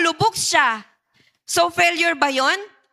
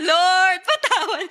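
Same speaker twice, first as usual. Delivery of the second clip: laughing mock plea; 4 semitones higher, slower than usual. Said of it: "Anong karugtong?".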